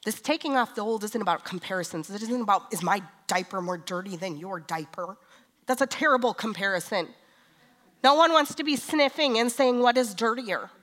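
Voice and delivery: loud, high fervent preaching; full-force preaching. Acted. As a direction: preach it out to the whole church.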